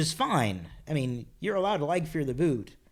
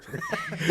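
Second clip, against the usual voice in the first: high laughter; 4 dB quieter than usual. Real, not acted. Laughing at own story.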